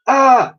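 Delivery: high loud startled scream; girl's scream. A narrator's reading. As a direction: shriek in fright. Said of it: "screamed the girl".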